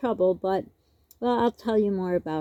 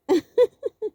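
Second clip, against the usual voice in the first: high laugh; 12 semitones higher, 4 dB louder than usual. Real, not acted. Laughing at the joke.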